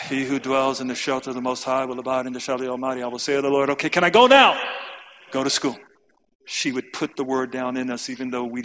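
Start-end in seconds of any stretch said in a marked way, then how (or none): 0.1-3.7 rushed recital. Retold or imitated. Kid reciting psalm in a hurry. 3.7-4.6 loud, high whiny plea to be let go; the bored boy cutting prayer short, loud and high; impatient kid. Retold or imitated. Boy begging mom to leave for school, loud whiny plea. Kid rushing through Psalm recital.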